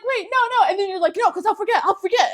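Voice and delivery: high-pitched mock urgency; self-mocking imitation of interrupting; frantic interrupting. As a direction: butt in, high and insistent. Imitates own panicky interrupting.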